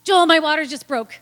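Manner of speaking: loud, high call across the house; yelling. Retold or imitated. Calling to husband from the bathroom, loud yell. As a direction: shout it to your husband. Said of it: "and I yelled".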